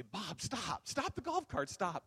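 high, urgent playful cry; excited and silly. Retold or imitated. Excited preacher acting out his shout to the driver, silly and urgent. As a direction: call out excitedly, pitch leaping up. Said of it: "I get excited, and I'm being silly".

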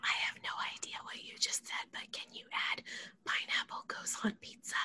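quiet whispered request; whispering. Pretend. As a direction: whisper it back. Whispers back.

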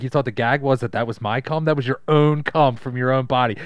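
loud, gloating taunt; hammed-up taunt; mock gloating. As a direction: rub it in like a crude prankster. Acting out a taunting retold quote.

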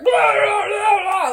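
high, loud babbling wail; imitated hysterical freak-out. Nanny whose outburst makes baby cry, hysterical babble. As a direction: blubber nonsense at a shout. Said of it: "the baby starts crying 'cause suddenly I'm like".

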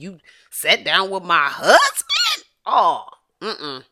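wife scolding the man who talked on air; indignant Jada. Acted. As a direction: scold him like a betrayed wife. Voicing Jada scolding the lover.

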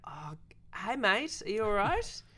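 high, startled call to a stranger; nervous friendly check. Retold or imitated. Film crew greets a staggering stranger, high and startled. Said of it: "And we were like".

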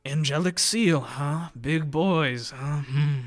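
smug sneering officer; smug mocking. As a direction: sneer over their papers. Officer mocks papers.

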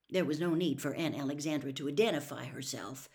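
usual speaking voice

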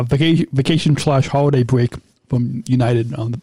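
usual speaking voice

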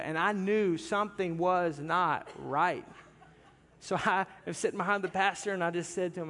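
ordinary talk

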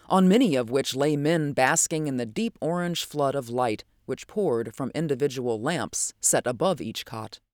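usual speaking voice

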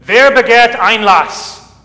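loud, slow, solemn call through a closed door; monk calling through the door. Retold or imitated. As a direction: call out the challenge slowly, like a monk behind the gate. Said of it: "calling throughout the door".

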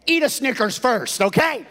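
playful preacher punchline. Acted.

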